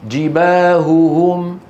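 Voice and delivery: جباههم slow, each هاء said clearly; both ha clear. Shown as a demonstration. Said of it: "فيبين الهاءين". (نطق الهاءين في جباههم بوضوح وببطء)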